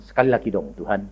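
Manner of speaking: quiet, hushed plea to God; soft, low whispered prayer; softly. Retold or imitated. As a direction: ask it gently under your breath. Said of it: "saya ngomong pelan-pelan".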